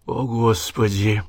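slow breathed-out exclamation; sedated patient breathing out his horror; exhaled, sedated sigh. A narrator's reading. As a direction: let it out on a drugged breath. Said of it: "выдохнул Гаспарило".

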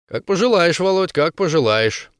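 ordinary delivery, nothing marked

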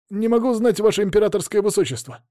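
high, fast frightened soldier. A narrator's reading. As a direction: blurt it out, scared private. Frightened soldier answers prince.

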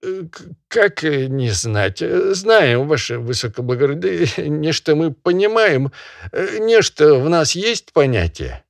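high, stammering peasant voice with a nervous laugh; fawning peasant. Acted. Peasant fawning to the barin, high and stammering. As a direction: grovel and stammer before the master.

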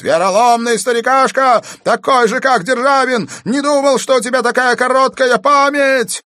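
very high, loud wailing demand; madman's yell. A narrator's reading. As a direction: yell it like an escaped lunatic. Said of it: "завопил Пельт". (истошный вопль безумца)

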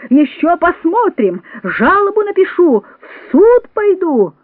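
high, drawn-out indignant cries; crying out threats. A narrator's reading. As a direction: call each threat out high and slow, appealing for justice. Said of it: "выкрикивала".

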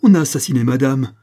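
loud, horrified outcry; cry of horror. A narrator's reading. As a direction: cry out the terrible discovery. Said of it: "recula avec un cri d'horreur". (cri d'horreur)

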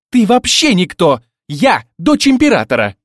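loud, high haughty outrage; angry flare-up. A narrator's reading. Emperor's daughter belittling her rival, angry high shout. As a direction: pull rank at full indignant volume. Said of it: "вспыхнула Злата".

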